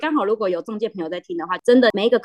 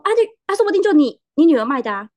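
higher, louder mock uncle guessing; lightly hammed uncle, pitched up; excited old uncle; 7 semitones higher, 5 dB louder than usual. Uncle guessing the daughter sold it, mock excited. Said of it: "然后他就说".

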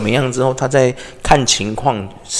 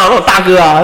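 ordinary talk, nothing marked

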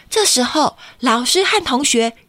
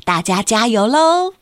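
usual speaking voice

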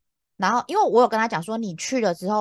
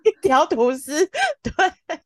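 surprised; 9 semitones higher, 5 dB louder, much slower than usual. Retold or imitated. Finding the toast.

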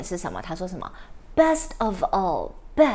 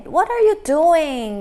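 scolding; 6 semitones higher, 7 dB louder than usual. Acted. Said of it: "little girl就说什么".

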